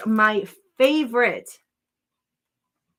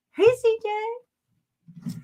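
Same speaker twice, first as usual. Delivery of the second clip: very slow, much higher drawn-out hello; sing-song greeting; 14 semitones higher, much slower than usual. Pretend. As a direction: stretch the hello out like a little tune. Greeting a viewer in chat.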